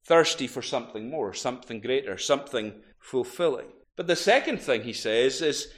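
normal talking voice

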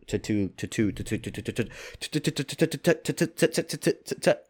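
"tattoo" repeated, fading away like an echo; mock echo. Toying with the slip, echoing "tattoo" till it fades. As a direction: bounce "tattoo" off a canyon wall until it dies out.

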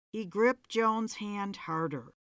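ordinary delivery

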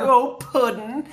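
slow, lightly voiced Harley coo; a touch of Harley's lovestruck sigh; Harley Quinn voice. Fan imitating Harley sighing over the Joker, lightly voiced. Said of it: "I love the way she's like".

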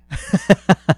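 loud high burst of laughter. Real, not acted. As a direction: crack up at your own bit. Laughs after his own rant.

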